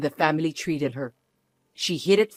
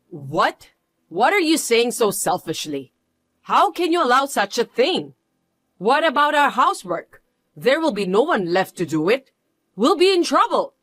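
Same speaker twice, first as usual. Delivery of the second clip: outraged protest; 5 semitones higher than usual. Acted. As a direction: play the outraged mother-in-law, voice climbing. In-law protests daughter leaving.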